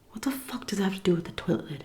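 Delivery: a fast whispered aside to herself; whispered inner thought. Retold or imitated. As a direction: rush it under your breath, baffled. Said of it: "I was thinking this".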